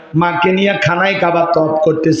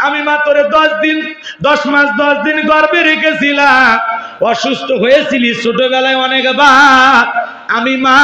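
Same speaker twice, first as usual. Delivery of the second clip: impassioned preaching; 8 semitones higher than usual. Acted. Preacher voicing a mother pleading with her son, high and wailing. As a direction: lift it into a pleading mother's lament.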